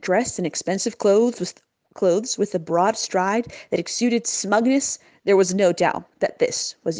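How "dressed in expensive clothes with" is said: ordinary delivery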